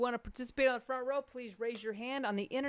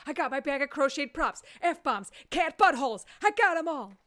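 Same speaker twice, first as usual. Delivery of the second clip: higher, louder boastful voice; mock proud audience member; 5 semitones higher, 7 dB louder than usual. Comedian voicing a proud audience member, loud and boastful. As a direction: brag about the bag of props. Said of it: "standing there with his".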